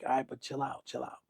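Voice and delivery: very soft retold undertone; hushed calming. Friend urges Fifty to calm down in elevator, hushed. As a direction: quietly talk a friend down.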